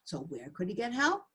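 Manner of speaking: ordinary delivery, nothing marked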